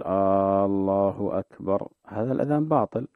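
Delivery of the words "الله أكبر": "الله" with the opening a drawn out long, aaallah, slow; "الله أكبر" starting on a long dragged a, like a question; stretched first vowel. Shown as a demonstration. Sheikh imitating callers who stretch the first vowel. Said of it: "يمد، يقول".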